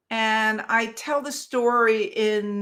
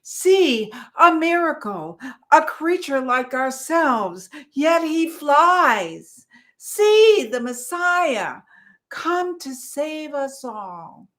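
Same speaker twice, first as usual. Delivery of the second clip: high, loud amazed shouts; awed crying out; 5 semitones higher, 5 dB louder, slower than usual. Acted. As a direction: hail a miracle in wonder.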